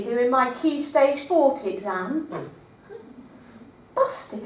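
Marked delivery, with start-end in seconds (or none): 3.9-4.4 small, quiet "Busty?" in dismay; quiet shocked "Busty?"; horrified realisation. Acted. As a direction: notice the typo with a sinking hush.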